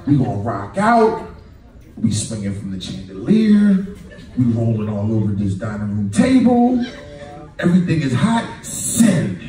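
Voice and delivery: low, soft seductive crooning; low lover's voice reading his freaky text. Retold or imitated. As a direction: croon it like a late-night sweet talker. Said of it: "I'm like".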